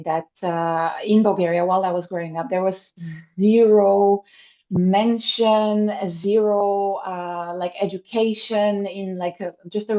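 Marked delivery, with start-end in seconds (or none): 3.4-7.0 zero stressed hard and louder each time; emphatic zero. Real, not acted. Stressing zero while telling about school in Bulgaria. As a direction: lean on zero, both times.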